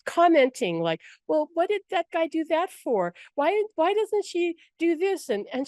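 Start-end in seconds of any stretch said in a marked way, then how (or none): 1.3-5.3 high-pitched nosy movie heckler; mock heckling. Angels commenting through her friend's movie, high mock heckling. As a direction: pester with questions in a raised, put-on voice.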